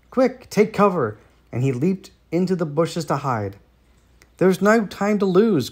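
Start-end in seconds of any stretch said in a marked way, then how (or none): 0.1-1.2 higher, sharp storybook alarm; urgent warning. A narrator's reading. Turkey warns the birds before hiding, urgent higher cry. 4.4-5.7 higher, louder storybook cry; urgent cry. A narrator's reading. Chicken Licken hurries the other birds, urgent higher cry. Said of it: "cried Chicken Licken".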